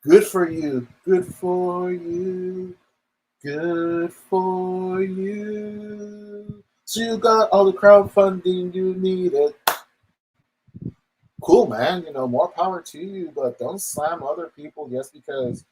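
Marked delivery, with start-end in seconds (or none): 0.0-0.9 sarcastic. Acted. I'll even give you the golf clap. 1.0-2.7 higher, very slow sarcastic drawl. Acted. I'll even give you the golf clap. 3.4-6.6 very slow, higher sneering drawl; sarcastic drawl. Acted. I'll even give you the golf clap. 6.8-12.0 sarcastic. Acted. Mocking a successful creator.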